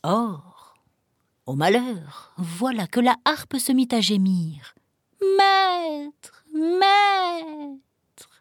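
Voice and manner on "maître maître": high, far-off whimpering call; moaning harp. Acted. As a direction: wail like an enchanted harp. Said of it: "sa plainte".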